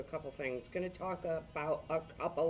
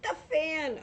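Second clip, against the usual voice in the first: sudden high exclamation, pitch jumps up; surprised cry; 11 semitones higher, 5 dB louder than usual. Real, not acted. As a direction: blurt it out on noticing the fan. Spots dirty fan, exclaims.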